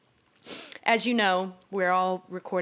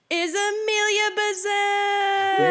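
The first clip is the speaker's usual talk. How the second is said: big, slow announcer shout; 13 semitones higher, 6 dB louder, much slower than usual. Pretend. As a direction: announce her name like a game show host. Announcing the guest host.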